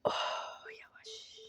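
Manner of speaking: very soft breath without voice; barely audible whispered caution; tense whisper. Real, not acted. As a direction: whisper it under your breath while steering carefully. Tense careful parking manoeuvre.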